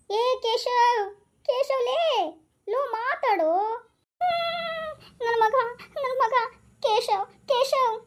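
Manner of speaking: high pleading calls to a silent son; desperate calling. Acted. Father calling his silent son to speak. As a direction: beg him to answer. Calling unresponsive son's name.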